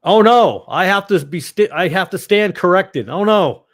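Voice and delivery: high, loud dismayed "oh no" at her own mistake; surprised oh no. Real, not acted. Streamer realizes she got a fact wrong, loud surprised dismay.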